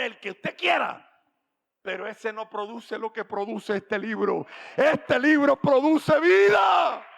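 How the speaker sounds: high, loud shouted sermon, slowing on key words; fiery preaching. Real, not acted. Pastor proclaiming the Bible gives life, at full force. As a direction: preach it to the rafters.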